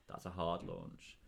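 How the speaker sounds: "hard" leaned on, slow and low; slow down and press "hard" against soft; stress on hard. Shown as a demonstration. Explaining terms to a co-host, pressing "hard". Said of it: "If I release it to everywhere".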